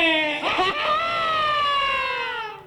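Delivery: long high-pitched laugh. Real, not acted. Host laughs at own joke.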